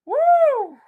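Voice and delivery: a loud, high whoop of delight; excited whoop. Real, not acted. Host whoops loudly at the clip he just played. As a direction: whoop it out like the crowd just scored.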